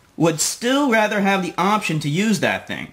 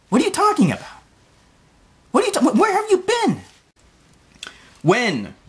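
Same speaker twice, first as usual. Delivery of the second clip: incredulous; 12 semitones higher than usual. Acted. Incredulous rant at Joe.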